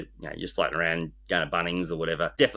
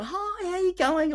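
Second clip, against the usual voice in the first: very high, drawn-out imitated hello; slow sing-song mock greeting, way up high; cheery greeting; slower than usual. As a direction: greet them like an overfamiliar shopper. Voicing shopper greeting him cheerily.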